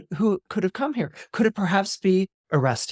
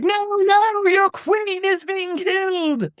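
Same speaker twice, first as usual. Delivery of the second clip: terrified cry; slower than usual. Acted. Clytemnestra waking to her son's attack, shrieking in terror. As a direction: scream for help as the blade comes. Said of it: "she cries out".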